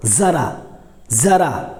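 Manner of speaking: जरा said as "zaraa", low-pitched and careful; isolated "zaraa" for जरा, repeated; nukta z sound. Shown as a demonstration. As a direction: open जरा on a buzzing z. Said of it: "लेकिन जरा मतलब थोड़ा".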